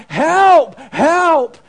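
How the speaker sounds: loud, high cries for help; man in gorilla suit yelling under the lion. Acted. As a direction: keep shouting, desperate. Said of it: "as he was yelling out".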